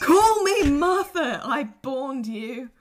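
high, loud, slow mock mum voice; hammed-up mother. Friend playing a demanding mum, hammed up. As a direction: boom it out like a pompous mother.

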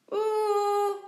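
high, loud held howl; bawling wail. A narrator's reading. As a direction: keep wailing like a small child. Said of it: "brult kleine Jan weer".

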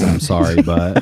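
fake apology. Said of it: "I'm the one that says".

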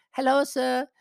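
high-pitched mock Chinese accent; imitating young Chinese masseuse. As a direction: offer it in a high girlish voice with a put-on Chinese accent. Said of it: "this young girl".

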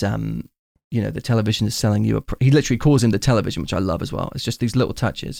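normal talking voice

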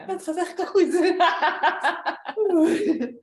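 high, loud laughing through words. Acted. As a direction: giggle while claiming to feel better. Role-play sharer laughing.

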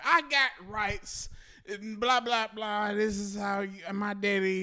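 loud, high buddy rant; hammed-up imitation of an outraged friend; mock indignant protest. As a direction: protest loudly, stretching the opening words. Buddy's retold protest to police.

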